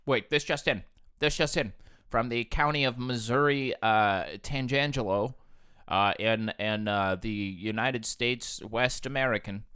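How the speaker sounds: mock anchor tossing to the field reporter; news anchor. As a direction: announce it like a TV anchor cutting in. Fake news anchor bit.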